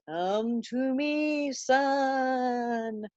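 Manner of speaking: slow, eerie beckoning ghost voice; creepy ghost. Acted. Ghost luring Hamlet into the fog, slow eerie beckon. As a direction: draw him in like a spooky phantom.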